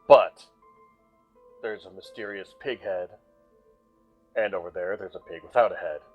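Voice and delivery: ordinary talk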